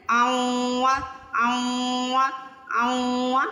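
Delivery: اوا hummed through the nose; ghunna drill. Shown as a demonstration. Teacher drilling the nasal sound for students. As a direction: repeat اوا with a nasal hum. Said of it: "یہ غنہ ہے".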